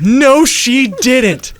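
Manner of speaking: high, loud, drawn-out cry; screamed denial. A narrator's reading. Nikki denies that Sarah killed their parents, screamed. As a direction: scream it slowly in Nikki's voice, hammed-up. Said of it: "Nikki screamed".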